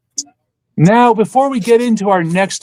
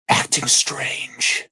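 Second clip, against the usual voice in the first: breathy slow whisper of a strange change; 6 semitones lower, 6 dB quieter, slower than usual. Acted. Reading a silly poem to friends, slow whisper. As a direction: drop to an eerie whisper.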